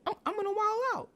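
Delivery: high, fast put-on voice, going wild; mock wild. Narrator voices the driver vowing to wild out, high and fast. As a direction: crow it like you're out of control. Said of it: "she said".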